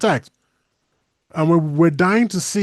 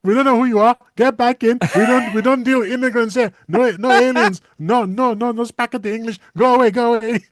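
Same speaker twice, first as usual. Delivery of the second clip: high, raised mock border guard; mock hostile American; 6 semitones higher than usual. Americans turning the alien away, high hammed-up rejection. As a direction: shoo him off in a high hammed-up voice. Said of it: "You go".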